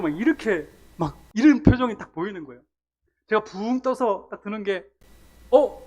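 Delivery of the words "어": startled huh. Retold or imitated. Mid-air moment in crash.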